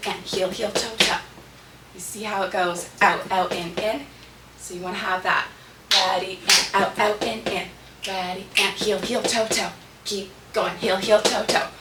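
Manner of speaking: ordinary talk, nothing marked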